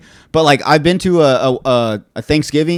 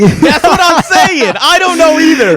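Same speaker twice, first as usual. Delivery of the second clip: much higher and louder, stretched out slowly; emphatic agreement; 11 semitones higher, 5 dB louder, slower than usual. Real, not acted. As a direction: drag it out big, like you can't believe it. Exaggerated agreement, ranting about Thanksgiving.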